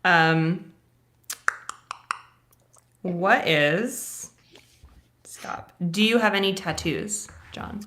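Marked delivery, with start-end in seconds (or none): none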